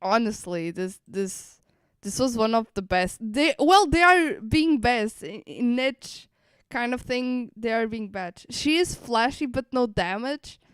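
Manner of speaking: ordinary delivery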